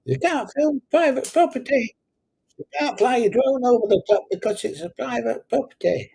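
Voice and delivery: high, hammed-up voice of an irate property owner; mocking angry complainer. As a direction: squeak it out like someone kicking off. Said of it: "those that kick off".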